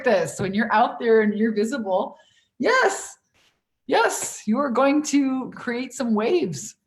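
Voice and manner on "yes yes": ordinary talk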